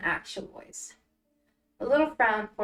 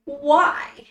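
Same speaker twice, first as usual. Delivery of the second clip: loud distressed; 4 semitones higher, 9 dB louder than usual. Acted. Al's outburst.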